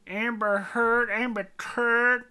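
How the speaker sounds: high, loud and slow mocking pun. Real, not acted. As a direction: belt out the silly rhyme. Joking name pun.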